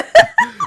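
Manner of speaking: high squealing laugh; burst of laughter. Real, not acted. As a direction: break into a shrieking laugh. Laughing at own joke.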